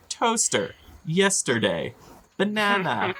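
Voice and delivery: high, put-on babble of random words; botched goblin speak. Game master voicing what the goblins hear, silly high voice. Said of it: "it sounds like somebody saying".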